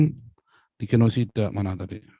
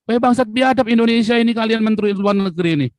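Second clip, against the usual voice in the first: mock outcry; 8 semitones higher, 3 dB louder than usual. Imagined outcry at Indonesian ministers.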